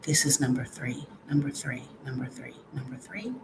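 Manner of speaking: whispered test count, no voice. Shown as a demonstration. Whispering the fan setting test to viewers. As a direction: breathe the words out softly.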